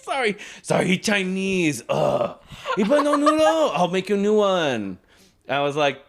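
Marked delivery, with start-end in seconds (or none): 0.0-5.0 high, hammed-up Japanese accent; mock Japanese accent. Sushi worker apologizing for missing noodles, mock Japanese accent. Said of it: "I'll do the accent".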